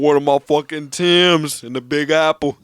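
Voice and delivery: loud, slow, put-on New York tough-guy voice; exaggerated New York accent; mock New York accent. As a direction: ham up a New Yorker bragging. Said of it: "they don't say shit like that".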